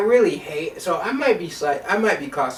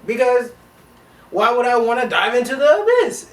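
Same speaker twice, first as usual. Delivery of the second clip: indignant reply; 8 semitones higher, 6 dB louder than usual. Retold or imitated. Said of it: "And I'm like".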